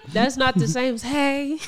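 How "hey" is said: higher put-on voice imitating the ex-friend; drawn-out mimicked "hey"; ex-best friend's voice. Woman mimics ex-best friend's voice note, put-on voice. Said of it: "that's her voice".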